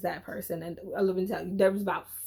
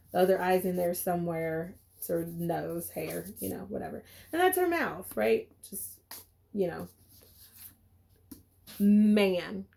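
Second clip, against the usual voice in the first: ordinary talk, nothing marked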